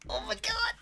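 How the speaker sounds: tiny, very high, soft squeal; overwhelmed squeak. Real, not acted. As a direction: squeak it out, overcome by cuteness. Overcome by cuteness.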